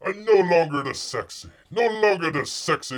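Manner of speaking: loud, hammed-up bear voice; mock bear lament. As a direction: wail like a vain bear. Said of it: "He says".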